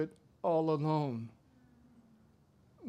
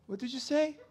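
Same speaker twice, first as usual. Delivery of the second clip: puzzled; 9 semitones higher, 3 dB louder than usual. Acted. Elijah straining to hear.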